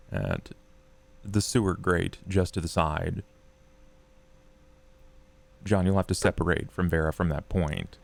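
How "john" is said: ordinary talk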